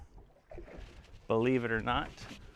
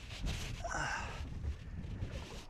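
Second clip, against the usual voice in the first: weary sigh; 14 dB quieter than usual. Real, not acted. Video flopped.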